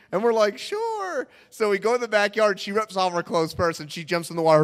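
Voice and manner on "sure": soft high falsetto agreement; squeaky eager yes. Retold or imitated. Brothers invited skinny dipping, squeaky eager yes. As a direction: pipe up, pitched way high.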